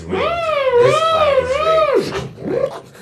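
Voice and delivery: high, slow outraged yell; outraged shout. Acted. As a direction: wail that the fight is rigged. Protests the rigged fight.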